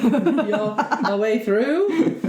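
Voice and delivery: slow, laughing echo of the phrase; amused slow echo of "our way through"; laughing repeat. Retold or imitated. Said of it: "Did you say barreled?".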